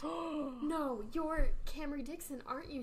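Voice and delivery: surprised. Acted. Girl suddenly recognises her.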